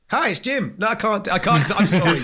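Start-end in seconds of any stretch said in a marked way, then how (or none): 0.1-0.8 higher, half-hearted go at Jim's accent. Shown as a demonstration. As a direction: try Jim's accent, then give up. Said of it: "give us your best Jim impression".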